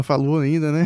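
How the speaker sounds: ordinary talk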